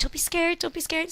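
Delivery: high, gentle reassurance; reassuring son. Retold or imitated. Mother reassuring her son between contractions, gently high.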